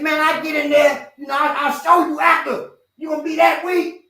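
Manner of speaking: loud, high, cocky challenge; taunting coach. Retold or imitated. Coach berating him in retold story.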